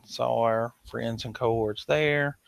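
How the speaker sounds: normal talking voice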